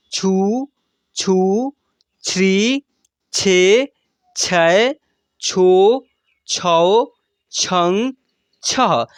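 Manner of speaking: each syllable spoken slowly and separately; barakhadi recital. Shown as a demonstration. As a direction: sound out every syllable clearly for the class. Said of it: "ऐसे उच्चारण करेंगे". (हर अक्षर अलग-अलग साफ़ बोलना)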